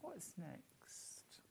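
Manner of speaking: whispered aside off mic. Real, not acted. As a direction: breathe the question quietly to yourself. Aside while fetching next fabric.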